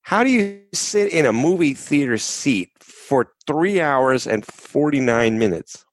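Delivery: incredulous. Real, not acted. Incredulous about a long movie.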